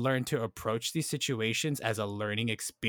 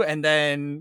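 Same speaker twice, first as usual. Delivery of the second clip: usual speaking voice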